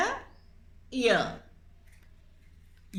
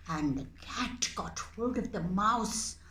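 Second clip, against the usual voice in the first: ordinary talk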